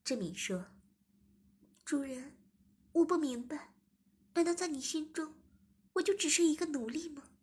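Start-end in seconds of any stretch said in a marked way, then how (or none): 1.8-7.3 high, hurt questioning; hurt plea. Acted. Zhimin asks the master if she is only a slave, high hurt voice.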